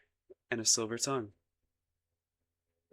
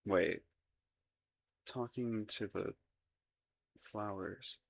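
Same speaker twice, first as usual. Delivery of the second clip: soft, halting murmur; slow down and trail off as it sinks in; dawning realization; 6 dB quieter, slower than usual. Acted. Prince realizing he was overheard with the flowers, stunned.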